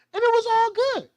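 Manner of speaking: high squeaky gloating voice; high mock cat boast. Mocking the cats' past reign in a high gloating voice.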